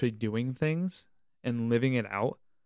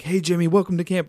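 higher, louder hyped-up camp greeter; over-the-top cheery welcome; 6 semitones higher, 7 dB louder, faster than usual. Retold or imitated. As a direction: gush the welcome like an overeager counselor. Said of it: "they're not going to be like".

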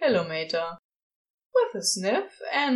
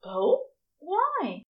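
higher, curious angel voice; Aziraphale; 9 semitones higher than usual. A narrator's reading. Angel asking why they keep their distance, curious and higher. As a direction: ask lightly, higher up. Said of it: "Aziraphale asks".

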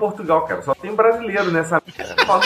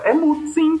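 retold insistent boss; 11 semitones higher than usual.

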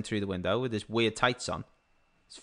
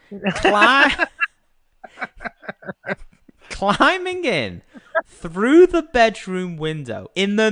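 laughing; 7 semitones higher, 6 dB louder, much slower than usual. Real, not acted. Cracking up mid-story.

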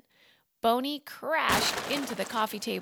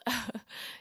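soft chuckle; 3 semitones higher, 6 dB quieter than usual. Acted. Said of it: "Boney chuckled".

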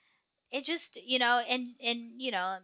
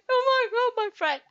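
loud, high mock wail; retold crying; 13 semitones higher, 8 dB louder than usual. Driving home after the dinner, imitated tearful wail. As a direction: act out wailing for a lost friend. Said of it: "I was driving home crying".

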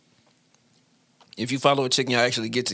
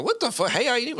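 raised, mocking girl voice; mock girl's reaction; 11 semitones higher, faster than usual. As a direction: mimic a scornful girl. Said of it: "the girl be like".

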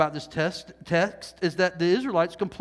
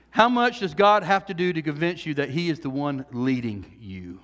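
ordinary talk

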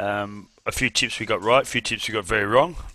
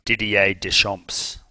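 French pronunciation; slower than usual. Pretend. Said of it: "sounds better when you put the accent".

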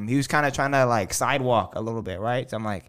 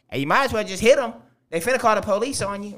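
light high crowd voice; 10 semitones higher, 4 dB louder than usual. Retold or imitated. Voicing the crowd egging on.

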